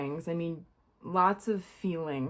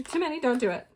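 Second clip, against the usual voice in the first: higher, louder half-joking yell at the cards; mock yelling at cards; 6 semitones higher, 3 dB louder, faster than usual. Real, not acted. Reader scolds her tarot cards for spilling, playful yell. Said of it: "like, yelling at the cards".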